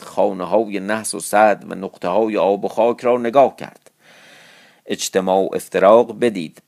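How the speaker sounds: measured classical storytelling voice; story narration. A narrator's reading. Storyteller reading an old Persian epic to listeners, measured narration. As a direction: read the old tale with formal cadence.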